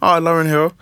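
high excited mock answer. Retelling [name] picking a sample, high mock eager. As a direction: pop it up like a sudden idea. [name] voiced in retold studio story.

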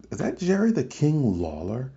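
half-laughing, incredulous double take; stunned disbelief. Retold or imitated. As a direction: pitch jumps way up in shocked disbelief. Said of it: "and I'm like".

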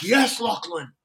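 high, loud pumped-up urging; enthusiastic hype. Retold or imitated. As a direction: hype your buddy up like a coach. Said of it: "I get very enthusiastic".

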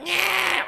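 loud, very high "nyeh" squawk; imitated pronounced bleat. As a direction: bleat it out loud, mimicking a disruptive noise. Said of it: "then it got more pronounced".

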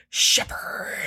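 long, whispered creature rasp; Todd the Wraith. Acted. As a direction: draw the name out in a menacing whisper. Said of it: "it's Todd the Wraith, is going".

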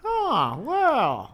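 put-on high cheery old man voice; chirpy elderly man greeting; happy old man. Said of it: "the same happy old man voice".

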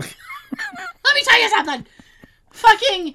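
high, slow laughing rant voice; high laughing mock tirade. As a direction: ham up a ranting crank.

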